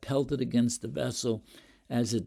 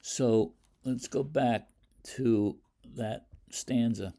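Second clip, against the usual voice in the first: normal talking voice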